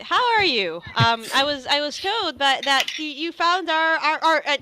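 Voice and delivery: high, loud, stumbling eagerness; eager scholar bubbling over; very enthusiastic. Acted. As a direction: gush excitedly, tripping over the words. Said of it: "very enthusiastic".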